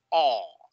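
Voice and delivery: "o" as long open aw; open-throat sound. Shown as a demonstration. Teacher coaching mouth position, open vowel. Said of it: "abre la boca".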